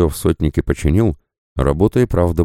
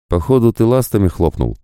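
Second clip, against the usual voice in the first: higher, cocky sidekick voice; cheeky mutant voice; 6 semitones higher than usual. A narrator's reading. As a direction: play a brash, boozy mutant. Fyf teases the revived.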